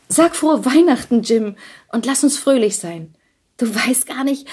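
Della high and urgent, begging him not to mind; pleading young wife. A narrator's reading. Della pleads with Jim over her cut hair, high and urgent.

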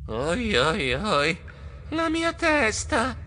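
groggy moan climbing to a high whine; dazed mumbling. A narrator's reading. Geronimo comes round with a bumped head, moaning dazedly. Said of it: "Quando rinvenni borbottai".

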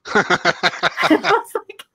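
helpless laughter breaking into the words; real laughing. Real, not acted. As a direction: try to talk while cracking up. Laughs telling the punchline.

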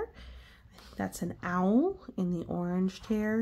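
ordinary delivery, nothing marked

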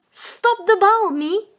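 high, loud urgent cry; urgent call. Acted. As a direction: yell a warning to the keeper. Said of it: "roept Boe".

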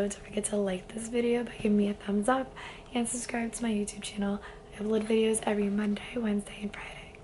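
soft, hoarse near-whisper; lost voice. Real, not acted. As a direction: voice completely gone. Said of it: "my voice is completely gone".

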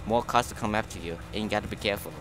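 usual speaking voice

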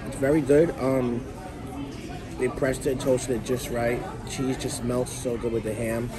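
ordinary talk, nothing marked

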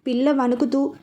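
ordinary delivery

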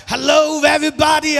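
high, booming mock greeting; trying out a voice. Shown as a demonstration. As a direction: belt out an over-the-top hello. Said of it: "or do I sound like".

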